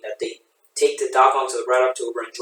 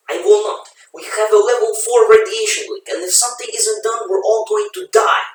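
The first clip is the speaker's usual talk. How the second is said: alarmed; 7 semitones higher, 3 dB louder than usual. Acted. Doctor protesting radiation leak.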